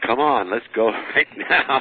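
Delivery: laughing eagerness to start the exorcism; eager, laughing. Retold or imitated. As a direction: urge her on with a laugh.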